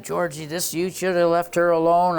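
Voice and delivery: a father scolding his son, half-shouted; retold yell. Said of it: "Dad was yelling at me".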